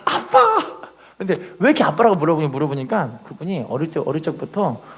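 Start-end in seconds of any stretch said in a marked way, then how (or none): 0.0-0.8 put-on very high, childlike call of Daddy; imitating a prayer. A preacher imitating a believer's childlike prayer, squealing Daddy. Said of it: "기도할 때 "아빠" 기도해요".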